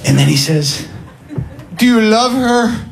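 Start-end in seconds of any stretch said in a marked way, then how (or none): 1.8-2.8 high, slow earnest question; earnest stranger. Retold or imitated. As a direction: ask it like an odd angel. Neighbour asks earnestly.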